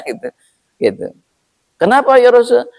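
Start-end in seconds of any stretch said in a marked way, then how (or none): 1.8-2.7 high, urgent call of a running owner; breathless owner calling. Acted. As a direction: call out to the Prophet in a hurry. Owner voiced in story. (pemilik unta memanggil dengan tergesa-gesa)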